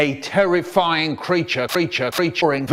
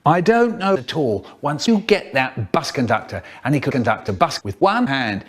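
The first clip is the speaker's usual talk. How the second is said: ordinary talk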